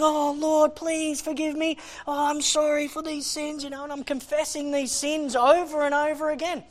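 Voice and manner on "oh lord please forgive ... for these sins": high, pleading cry of remorse. Retold or imitated. Preacher apologising to God for sins, high crying out. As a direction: act out begging, raised and drawn out. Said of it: "I'm crying out and going".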